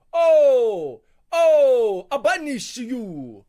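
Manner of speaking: very loud, high mock Italian shout; "banish" stretched out slowly in a hammed-up Italian accent; over-the-top Italian accent, shouting. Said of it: "I know I'm not an Italian guy".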